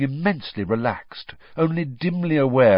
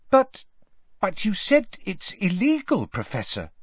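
uncertain; 10 semitones higher than usual. A narrator's reading. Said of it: "said Hermione uncertainly".

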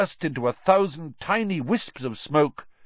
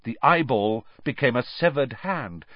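ordinary delivery

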